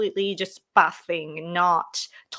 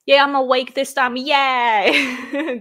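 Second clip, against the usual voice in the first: loud, higher-pitched happy cheer; 5 semitones higher, 7 dB louder, slower than usual. Real, not acted. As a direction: celebrate it with a loud yay. Cheers being awake on time.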